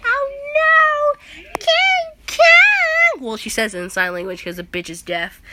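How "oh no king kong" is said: very high, loud shriek of alarm; girl crying out. Retold or imitated. The girl crying out over fallen King Kong, high shriek. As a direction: shriek his name like a frightened girl. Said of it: "the girl's like".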